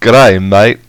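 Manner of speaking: loud, hammed-up Australian; mock Aussie host greeting; a lightly done Australian accent. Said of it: "talk like an Australian".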